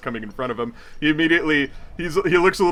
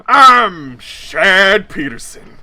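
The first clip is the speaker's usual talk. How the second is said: put-on loud, slurring drunk man, higher and louder; the drunk fiancé greeting a stranger in line, loud and slurred; drunk party guy; 6 semitones higher, 9 dB louder, slower than usual. Drunk Chad booming at the stranger in line. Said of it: "he perks up and says".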